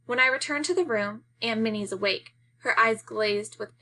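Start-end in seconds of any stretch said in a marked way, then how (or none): none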